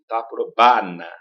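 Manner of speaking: "Taprobana" slow with an open, unnasalized "ba"; Italian-style "Taprobana"; Italian-style reading. Retold or imitated. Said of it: "O italiano falaria assim".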